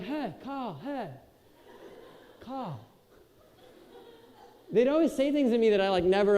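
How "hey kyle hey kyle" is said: surfer bro. Retold or imitated. Surfer roommates meeting Kyle, lightly voiced bro. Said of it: "They were like".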